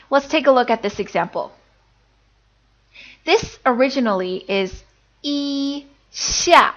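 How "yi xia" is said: "yi" held high and level, "xia" falling sharply; first tone, fourth tone. Shown as a demonstration. Teacher shows the original tones, "yi" level, "xia" falling. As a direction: say "yi" level, then drop "xia". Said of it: "the first tone and the fourth tone".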